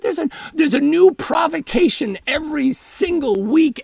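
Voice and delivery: usual speaking voice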